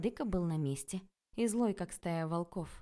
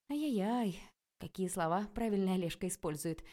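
ordinary delivery

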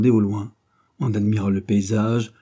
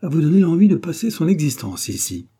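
higher, impulsive gush; a lifted, unguarded outburst; thoughtless blurt; 7 semitones higher, 5 dB louder than usual. A narrator's reading. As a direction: let it slip out with sudden enthusiasm. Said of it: "répondit-il étourdiment".

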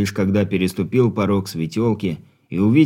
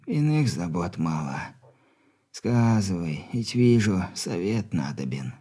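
put-on much softer frail old man; weak stern dying father; 7 dB quieter than usual.

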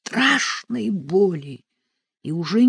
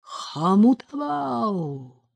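long drawn-out, higher incantation, falling at the end; loud ritual call; 5 semitones higher, much slower than usual. A narrator's reading. Sorcerer calling in a ritual, long drawn-out call. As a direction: call out the name, stretching it slowly. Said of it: "громко зовёт колдун".

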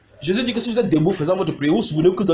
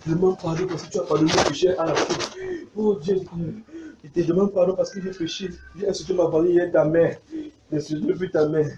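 lightly put-on pleading penitent; a hint of plaintive praying; mock repentant prayer; 3 semitones higher than usual. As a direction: imitate someone begging God's pardon. Acting out a shallow repentance prayer.

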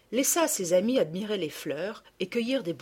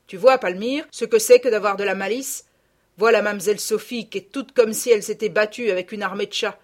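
loud, firm moral lecture; scold, then grudgingly forgive your daughter; rustic mother's stern forgiveness; admonishing country mother; 8 dB louder than usual. A narrator's reading.

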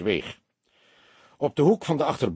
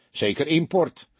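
higher, mildly sneering verdict; scornful; 7 semitones higher than usual. A narrator's reading. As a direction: dismiss the outsider with scorn. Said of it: "zei hij smalend". (smalend, met hogere stem)

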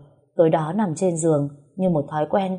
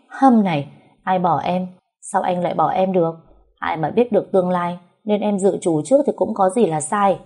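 ordinary delivery, nothing marked

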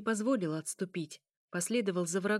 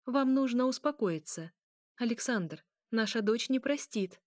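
usual speaking voice

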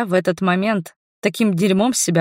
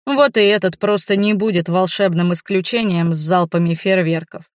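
usual speaking voice

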